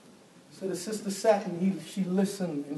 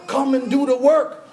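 Martha's demand; 6 semitones higher, 7 dB louder than usual. Retold or imitated. Martha commands her sister.

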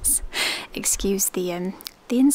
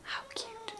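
high breathy whispered coo; soft cooing; 13 semitones higher, 19 dB quieter than usual. Real, not acted. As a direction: whisper it like it's precious. Cooing over tiny socks.

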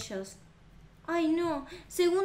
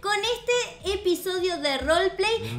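loud outro; 3 semitones higher, 4 dB louder than usual. Real, not acted. Channel outro to viewers.

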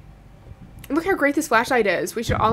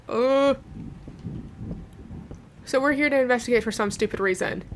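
ordinary talk

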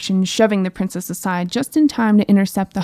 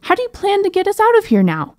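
panicked; 12 semitones higher than usual. Acted. Panicked princess facing hydra.

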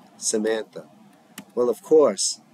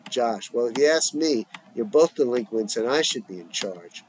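usual speaking voice

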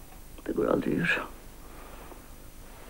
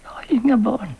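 higher and louder, pushing the words out; anguished repeat; 6 semitones higher, 3 dB louder than usual. Acted. Mother confessing to Selma, voice rising in anguish. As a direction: cry out the denial.

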